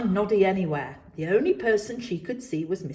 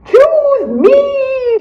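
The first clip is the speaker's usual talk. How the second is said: loud high trumpeting call; 15 dB louder than usual. A narrator's reading. Mister Jumbo trumpets at Noddy to be chosen. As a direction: trumpet it like an elephant. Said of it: "trumpeted Mister Jumbo".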